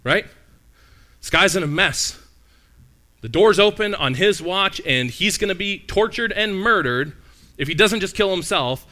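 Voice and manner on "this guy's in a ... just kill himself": urgent. Real, not acted. Preacher stresses jailer's danger.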